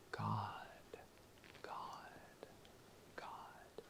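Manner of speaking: soft unvoiced whisper. Shown as a demonstration. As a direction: whisper the name, fading away. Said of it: "I will whisper".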